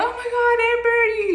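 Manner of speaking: high, loud scandalized exclamation; mock shock. Churchgoers reacting to her confession, high-pitched mock shock.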